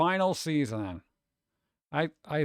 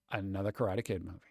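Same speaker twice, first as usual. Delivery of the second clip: much lower and softer, quick deadpan mutter; dry, muttered repeat; 8 semitones lower, 9 dB quieter, faster than usual. Real, not acted. To the chat, grumbling about another Karate Kid film, low deadpan mutter. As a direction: mutter it under your breath, unimpressed.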